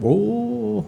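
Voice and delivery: soft, high, long "oh"; acted gentle amazement; wondering recognition. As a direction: let the realisation dawn in a high hush. Retold recognition of the child's lineage.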